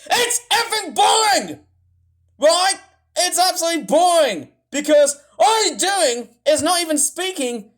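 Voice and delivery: furious outburst at the screen, voice cracking high; angry rant. Real, not acted. Ranting at a YouTuber who skips talking, furious shout. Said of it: "I'm starting to rant here".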